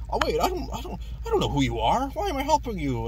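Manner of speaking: high put-on goofy Sandman voice; mock dopey high voice; imitating Sandman. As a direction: squeak it out like a confused cartoon villain. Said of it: "And then".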